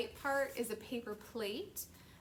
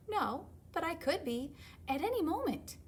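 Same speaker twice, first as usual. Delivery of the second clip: higher, pleading panda voice; stubborn little panda; 7 semitones higher than usual. Acted. Little panda insists to his parent, higher and pleading. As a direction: argue like a stubborn cub.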